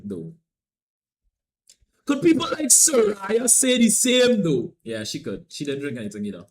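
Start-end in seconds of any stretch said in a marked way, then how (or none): 2.0-4.7 loud, high, drawn-out question; emphatic call-out. Real, not acted. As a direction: put the question to everyone, slow and loud. Repeats the question loudly for effect.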